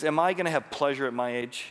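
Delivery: loud, mocking disbelief with a laugh; Sarah's loud scornful complaint; cynical Sarah. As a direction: scoff at her own old age. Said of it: "it seems angry, it seems cynical".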